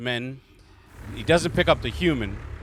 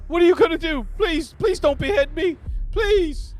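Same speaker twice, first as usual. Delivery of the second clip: much higher, louder pleading voice of a captive; frightened human prisoner pleading; begging prisoner; 6 dB louder than usual. Acted. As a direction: beg for his life in a squeaky voice. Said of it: "he's just like".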